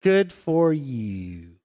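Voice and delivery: slow, drawn-out sarcastic good for you; sarcastic drawl. Pretend. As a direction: stretch it out, full of fake praise. Sarcastic reply to blessed friend.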